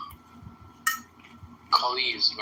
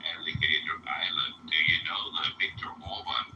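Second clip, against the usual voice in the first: low, monotone robot-like delivery; robotic voice; 9 semitones lower, 4 dB quieter than usual. Real, not acted. Caller asking about Viktor Orban, robotic deep voice. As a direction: speak deep, like a machine. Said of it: "Why do you sound like a robot?".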